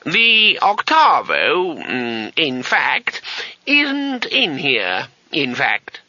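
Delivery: high, very slow hesitant evasion; slow old wizard. Acted. Old wizard evading the student's question, slow and high. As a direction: stall and hedge, stretching it out. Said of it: "said the voice slowly".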